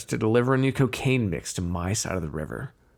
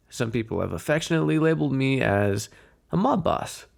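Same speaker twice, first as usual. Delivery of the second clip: usual speaking voice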